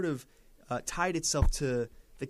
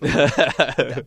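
laughing; 15 dB louder than usual. Real, not acted. Laughs mid-sentence at own remark.

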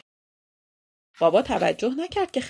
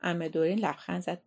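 plain reading voice

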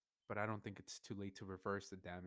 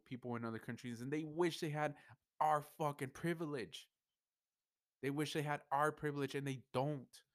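louder and higher, a heated, impassioned rant; emotional outburst; 6 semitones higher, 5 dB louder than usual. Real, not acted. Venting to viewers, getting worked up and louder. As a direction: pour out the frustration with real feeling. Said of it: "I get emotional about this shit".

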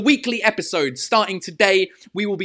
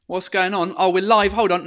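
ordinary talk, nothing marked

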